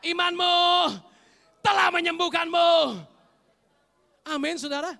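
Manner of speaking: shouted, high-pitched declarations; full-force preaching. Acted. Preacher declaring healing and demanding amens, shouted full-force preaching. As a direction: build to a roaring climax.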